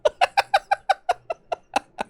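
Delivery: cracking up in a high squeal; high squealing laugh. Real, not acted. Laughing at own parody.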